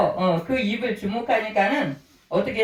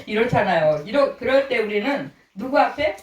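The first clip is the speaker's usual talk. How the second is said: ordinary delivery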